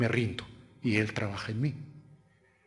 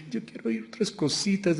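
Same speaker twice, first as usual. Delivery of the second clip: lightly voiced picky churchgoer; itching-ears listener; 6 semitones higher, 3 dB quieter than usual. Preacher imitates listeners with itching ears, lightly mimicking. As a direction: lightly mimic someone hankering for other teachings. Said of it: "teniendo comezón de oír".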